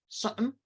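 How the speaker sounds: "something" with r and qim dropped, pitched high; high, soft "something", middle consonants left out; fully reduced form. Shown as a demonstration. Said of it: "They drop the r, they drop the qim".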